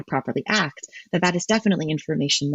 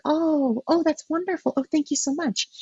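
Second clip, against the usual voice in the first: much higher, gushing appeasement; appeasing; 11 semitones higher than usual. Shown as a demonstration. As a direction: placate them sweetly, voice lifted high. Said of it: "my voice gets higher and softer".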